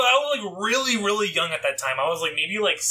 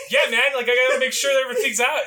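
put-on kid self, laughing; 6 semitones higher, 4 dB louder, faster than usual. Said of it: "And I'm like".

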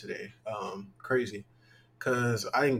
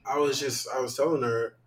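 ordinary talk, nothing marked

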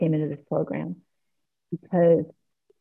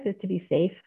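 usual speaking voice

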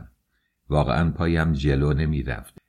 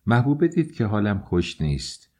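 ordinary delivery, nothing marked

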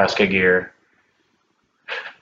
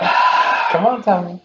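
shouting; 8 semitones higher, 3 dB louder, slower than usual. Real, not acted. Urging Tommy, shouting.

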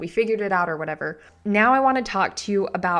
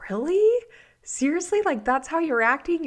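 exasperated disbelief; 9 semitones higher, 4 dB louder than usual. Retold or imitated. Coach voicing exasperated reaction.

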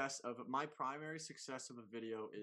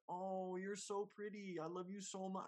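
fawning sweet voice; 9 semitones higher than usual. Pretend. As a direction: gush like a doting parent. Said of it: "the next day it's like".

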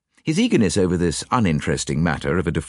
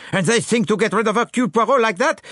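indignant. A narrator's reading. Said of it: "cried Poirot angrily".

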